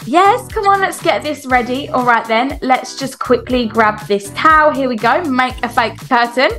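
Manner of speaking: normal talking voice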